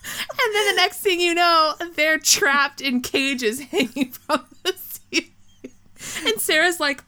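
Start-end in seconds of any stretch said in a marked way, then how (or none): none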